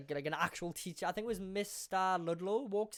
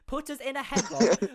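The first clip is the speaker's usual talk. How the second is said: ordinary delivery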